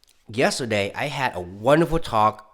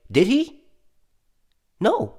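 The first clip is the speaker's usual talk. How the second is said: mock disbelief; 12 semitones higher, slower than usual. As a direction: pitch jumps far higher on a sarcastic did he. Rhetorical question answered himself.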